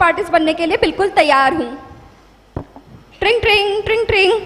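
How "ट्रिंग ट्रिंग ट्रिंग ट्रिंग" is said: imitated phone ring.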